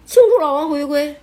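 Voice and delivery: loud, beaming announcement; proud member. Retold or imitated. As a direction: declare it like a celebration. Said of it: "不". (大声自豪地宣布)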